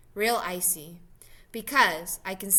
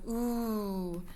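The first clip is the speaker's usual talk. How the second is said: soft, awed, drawn-out "ooh"; crowd's "ooh"; 5 dB quieter than usual. A narrator's reading. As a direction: coo like a crowd watching fireworks. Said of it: "and everybody goes".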